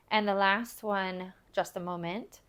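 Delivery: ordinary delivery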